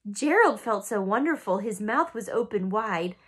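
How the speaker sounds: soft, hushed bedtime reading; storybook read-aloud, soft and lilting; story reading. Acted. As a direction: read it gently like a picture book to a baby. Reading picture book aloud.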